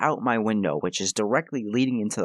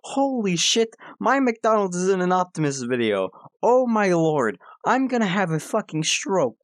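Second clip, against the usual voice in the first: freaking out; 9 semitones higher than usual. Retold or imitated. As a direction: squeal like you can't believe your luck. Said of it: "I start freaking the fuck out".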